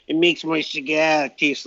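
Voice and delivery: lightly put-on California accent; a hint of a California drawl, slowed. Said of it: "that was my California accent".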